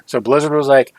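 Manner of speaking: ordinary talk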